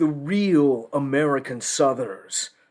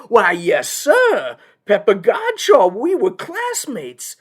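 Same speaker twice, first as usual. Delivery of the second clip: loud, higher, eager yes-sir voice; feigned enthusiasm; 6 semitones higher, 5 dB louder than usual. Acted. Lawyer claims a despised classmate to the judge, falsely enthusiastic. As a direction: play along heartily to please the judge.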